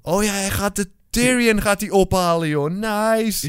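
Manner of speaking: high-pitched, delighted outburst; light up as it suddenly comes back to you; real thrilled surprise, pitched well up; excited realisation. Real, not acted. Sudden delighted realisation about the plot.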